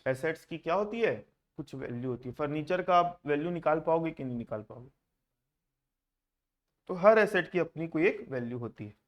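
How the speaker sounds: spaced-out dictation, word by word. Acted. Teacher dictating notes to class.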